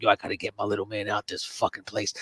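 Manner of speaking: low, quiet, set on rescue; low grim Dennis Quaid. Retold or imitated. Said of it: "He's like".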